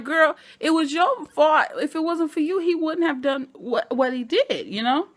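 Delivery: sassy scolding. Retold or imitated. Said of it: "and I'm like".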